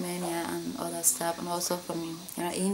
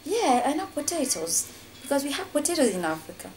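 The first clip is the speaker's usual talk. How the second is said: higher, clipped mock-annoyed reply; irritated; 7 semitones higher than usual. Girlfriend answering a stupid question about Africa, irritated. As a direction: retort as if the question insulted her. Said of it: "she was like; she was so pissed".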